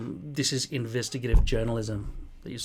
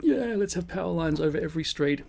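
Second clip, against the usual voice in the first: mock dumb agreement; 6 semitones higher, 3 dB louder than usual. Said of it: "you all just are like".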